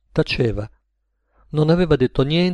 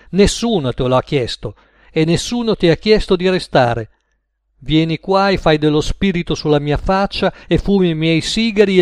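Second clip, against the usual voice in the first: rising, louder and higher furious rant; building rage; 6 semitones higher, 4 dB louder than usual. A narrator's reading. Ad working himself into a rage at Nick. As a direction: work yourself up into fury.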